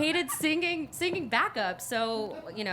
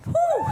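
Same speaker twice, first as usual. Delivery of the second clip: breathless laughing whew; 4 dB louder than usual. Real, not acted. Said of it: "I am heated".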